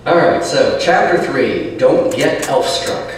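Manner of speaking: usual speaking voice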